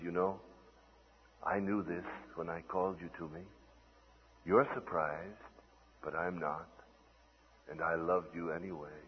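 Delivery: soft, low divine reassurance; hushed and low, reassuring the listener; gentle near-whisper. Acted. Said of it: "then he whispers to you".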